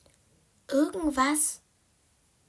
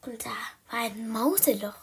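ordinary delivery, nothing marked

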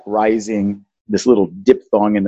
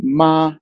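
"Ma" raised and held level; high, sustained, even "Ma"; first tone; 7 semitones higher than usual. Shown as a demonstration. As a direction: raise "Ma" and keep the pitch flat. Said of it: "Can you hear how I'm raising it?".

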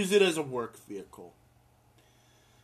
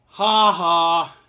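loud cackle; 12 dB louder than usual. Real, not acted. Gleeful laugh at the news.

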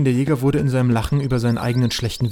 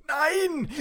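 high insistent; 5 dB quieter than usual. Acted. Character urges putting crown on.

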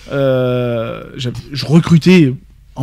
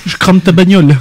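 louder, higher street threat; mock thug; 4 semitones higher, 6 dB louder than usual. Co-host joins the wesh candidate skit, mock thug threat. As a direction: threaten like a hammed-up young tough.

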